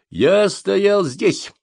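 very slow, high, loud shout. A narrator's reading. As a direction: hurl each word at the commissar. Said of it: "крикнул я комиссару".

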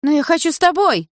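put-on high, loud girlfriend's protest; loud, insistent whine of protest; crying out. A narrator's reading. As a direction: cry out in high-pitched protest. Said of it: "выкрикнула Карина".

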